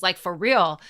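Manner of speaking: normal talking voice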